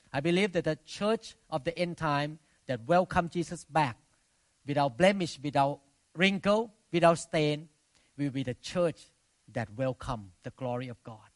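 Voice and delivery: ordinary delivery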